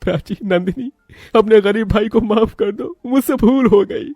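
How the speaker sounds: very high, crying apology; acted sobbing appeal to sisters; tearful plea. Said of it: "ये सुनकर महेश रो पड़ा".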